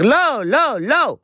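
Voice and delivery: put-on high whining no, like a small child; whiny toddler no. Mother imitates the toddler refusing to lie down, whining. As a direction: play the toddler refusing bedtime. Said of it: "הוא אומר".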